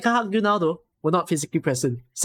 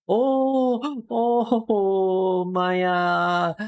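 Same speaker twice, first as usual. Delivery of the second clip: long drawn-out, high wailing oh's; gasp, wail, then let the name sink down; overcome with emotion; 7 semitones higher, much slower than usual. Real, not acted. Streamer greeting Maya, overcome with emotion.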